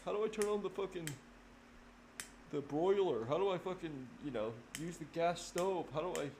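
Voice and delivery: clueless whine, pitched high; mock helpless adult. Mocking adults who can't cook, high clueless whine.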